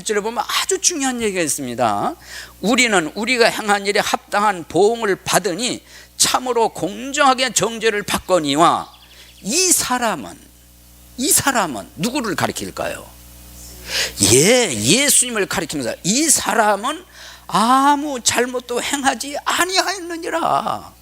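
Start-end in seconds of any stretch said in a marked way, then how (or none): 2.6-8.9 high, earnest admonition in quotation; mildly reprove, pitched up; rebuking. Acted. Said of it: "그를 꾸짖으며 이르되". 16.0-20.9 high, slow, drawn-out proclamation; rebuking declaration. Acted. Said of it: "그를 꾸짖으며 이르되".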